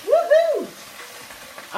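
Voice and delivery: high loud woohoo; sudden high cheering woohoo; excited whoop. Real, not acted. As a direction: whoop as it hits the pan. Dropping pasta into pan.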